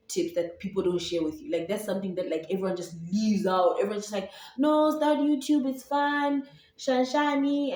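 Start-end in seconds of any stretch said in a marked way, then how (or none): 4.6-7.7 high, slow, drawn-out mimicry; higher, stretched-out mocking quote; mock breezy advice-giver. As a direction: imitate everyone's easy encouragement in a raised sing-song. Mimicking what everyone tells her.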